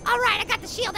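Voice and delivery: ordinary delivery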